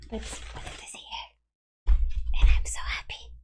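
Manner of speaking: breathy whisper of delight; hushed, giddy whisper. Real, not acted. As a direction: whisper it like a happy secret. Showing off a delivered t-shirt.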